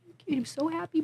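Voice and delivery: soft, high, shy little voice; quiet man. Retold or imitated. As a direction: imitate a quiet man's timid thanks. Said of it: "And he's quiet".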